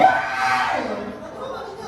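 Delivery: slow words broken by laughter; amused laughing tease. Real, not acted. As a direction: laugh through the punchline. Laughing about flirts' cliques.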